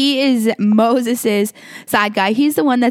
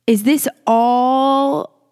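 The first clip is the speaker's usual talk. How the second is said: drawn-out slow, aching question; slow pleading question; 3 semitones higher, much slower than usual. Retold or imitated. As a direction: stretch it out as if heartbroken. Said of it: "they're like".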